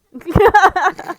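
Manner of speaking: big high laugh bursting out; loud burst of laughter. Real, not acted. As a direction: laugh out hard at the joke. Laughing after joke.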